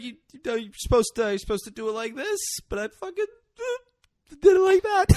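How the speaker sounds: high, breaking-down imitation; hysterical laugh-crying. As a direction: act out a crazed mother half laughing, half sobbing. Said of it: "she is laughing hysterically while crying".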